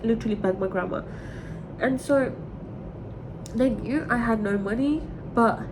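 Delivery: ordinary talk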